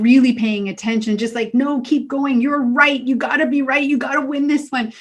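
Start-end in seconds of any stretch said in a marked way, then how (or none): 1.5-4.9 a lightly voiced higher, egging-on ego; ego's voice. Speaker voicing her ego urging her to keep arguing, a lightly raised cocky tone. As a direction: egg yourself on, a bit cocky. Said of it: "your ego prevents you from".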